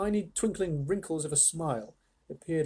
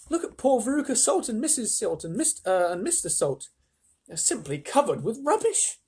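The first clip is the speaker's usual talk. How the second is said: Charlie's excited cry; 7 semitones higher than usual. A narrator's reading. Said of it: "cried Charlie".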